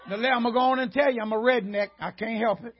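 usual speaking voice

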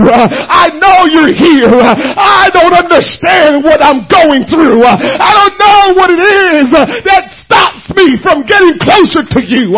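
high, loud, crying preacher's plea; full-force preaching. Acted. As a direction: build and cry out each line to God.

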